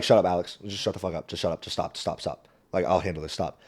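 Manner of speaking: low, very soft urgent aside; hushed shushing. Retold or imitated. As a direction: imitate quietly shutting someone up under your breath. Retold hushed aside to brother-in-law.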